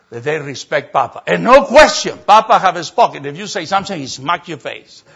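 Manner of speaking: normal talking voice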